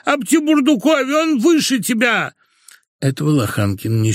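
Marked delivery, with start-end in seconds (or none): 0.1-2.3 very high, indignant wail; sudden wailing. A narrator's reading. Wife suddenly wails in defence of her lover. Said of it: "и вдруг заголосила".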